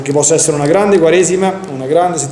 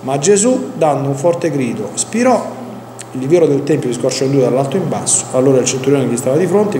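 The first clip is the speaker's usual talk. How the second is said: normal talking voice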